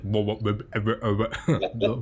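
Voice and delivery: repeated "wo-wo" mouth beat; beatboxing. Acted. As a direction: pump out wobbly W syllables like a beatboxer. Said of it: "like, a beatboxer".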